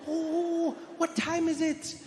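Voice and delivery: very high, drawn-out motherly voice; high-pitched guilty oh; mock surprised mom. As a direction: imitate mom suddenly realizing she forgot. Mom's reaction, acted by son.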